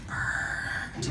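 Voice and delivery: unvoiced muttered jab; under the breath. A bystander in line muttering under the breath. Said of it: "under their breath was like".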